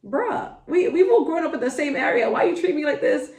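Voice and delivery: high, fast, incredulous protest; mock indignant reply to people who misjudge her; exasperated retort. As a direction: act out telling them off in disbelief. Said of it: "and I'm like".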